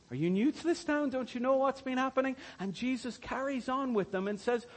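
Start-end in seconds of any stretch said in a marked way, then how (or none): 0.1-2.4 high incredulous question; can't believe he hasn't heard. Retold or imitated. Disciples quoted, incredulous.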